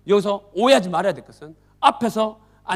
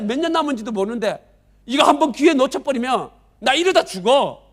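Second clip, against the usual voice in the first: louder, higher, indignant rant; bitter complaint; 5 semitones higher, 5 dB louder than usual. Acted. Sick man scolding other patients at the pool, indignant and loud. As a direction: scold the others who push ahead.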